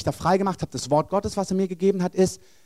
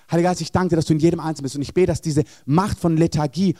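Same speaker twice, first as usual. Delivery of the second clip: a touch louder earnest prayer; 2 semitones higher, 4 dB louder, faster than usual. Acted. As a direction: lift it up to the Holy Spirit. Prays over those standing.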